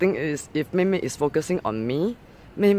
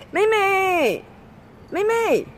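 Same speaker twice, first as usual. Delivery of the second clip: high, loud call across the playground; calling the dog; 12 semitones higher, 4 dB louder, much slower than usual. Real, not acted. Owner calling her dog across the playground, loud and high.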